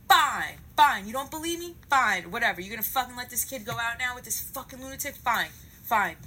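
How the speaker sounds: loud, bitten-off angry outburst; exasperated. Retold or imitated. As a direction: re-enact giving up in anger. Acted angry giving up on manager.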